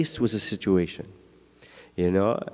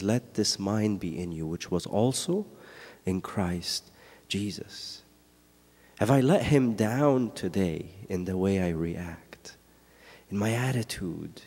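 softer, low, reflective reading sinking to a whisper; hushed verse; 4 semitones lower, 6 dB quieter, slower than usual. Acted. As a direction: say it quietly, as if to yourself. Verse repeated hushed, then whispered.

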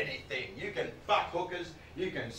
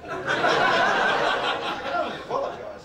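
laughing; 12 semitones higher, 7 dB louder, slower than usual. Real, not acted. Laughing at own voice.

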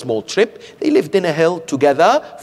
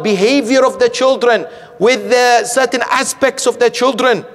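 higher, louder pulpit appeal; fervent preaching; 8 semitones higher, 4 dB louder than usual. Acted. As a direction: urge the congregation to help parents.

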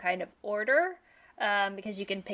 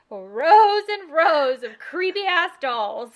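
slow, drawn-out, louder and higher; spooky emphasis; 6 semitones higher, 10 dB louder, much slower than usual. Real, not acted. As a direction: stretch it out like a ghost story. Describing the creepy dolls.

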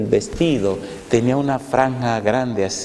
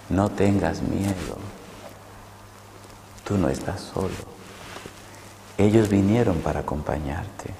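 soothing voice; 8 dB quieter than usual. Retold or imitated. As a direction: comfort a frightened man gently. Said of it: "esa voz me seguía hablando".

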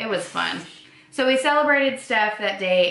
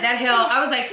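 usual speaking voice

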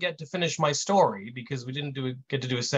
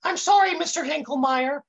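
much higher, dopey intern voice; high kid voice; 12 semitones higher, 4 dB louder than usual. A narrator's reading. Intern apologizing to Mister Hinklemeyer, high voice. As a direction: pitch it way up like a hapless kid. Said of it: "the kid says in this high voice".

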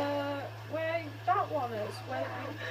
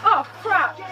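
normal talking voice

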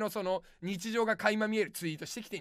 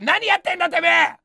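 louder, higher comic yell; mock fury; 5 semitones higher, 8 dB louder than usual. Comedian yelling at a viewer testing the cheap donation, mock-angry shout. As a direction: blow up at the viewer for fun.

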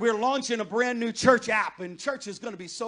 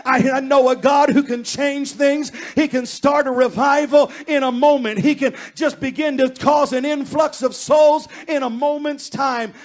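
loud, fervent sermon climax, louder all through; full-force preaching; 3 semitones higher, 9 dB louder than usual. Acted. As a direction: build to a shouted, pleading prayer over the congregation.

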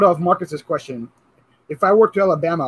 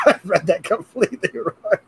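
higher, laughing outburst; 9 semitones higher than usual. Real, not acted. Host admitting a misread to guests, laughing. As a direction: burst out laughing through the words. Cracking up over own misreading.

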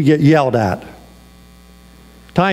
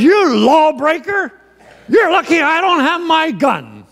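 threatening yell; 13 semitones higher than usual. Retold or imitated. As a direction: snarl a threat at the trespasser. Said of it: "And the guy yells again".

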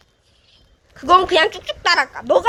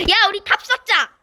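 ordinary talk, nothing marked

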